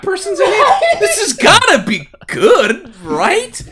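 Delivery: high, loud, laughing disbelief; mock excitement. Reading the cast on the cover, high laughing glee. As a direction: squeal it like a fan who can't believe it.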